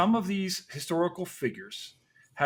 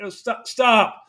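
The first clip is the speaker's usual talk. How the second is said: mock plantation drawl; 6 semitones higher, 8 dB louder, slower than usual. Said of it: "This is this high plantation".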